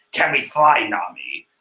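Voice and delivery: low gravel-edged Snake impression, half whispered; Solid Snake voice. Luffy asking Nami to fly, done as Solid Snake. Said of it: "I think I got it".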